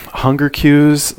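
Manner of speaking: high, slow, sneering "hunger cues"; mocking quote. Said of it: "who talks like that?".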